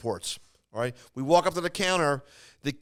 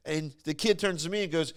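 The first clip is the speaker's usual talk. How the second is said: normal talking voice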